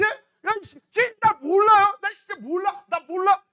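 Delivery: high, loud giving-up outburst; exasperated bladder. Acted. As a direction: wail at the boss that you can't hold on. Bladder gives up on owner. (못 버티겠다는 방광의 높고 큰 절규)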